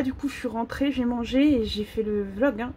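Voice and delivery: usual speaking voice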